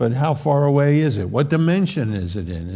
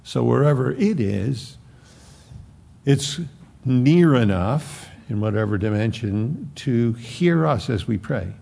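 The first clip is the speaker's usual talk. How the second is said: normal talking voice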